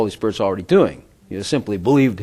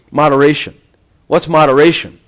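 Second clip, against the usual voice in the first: ordinary talk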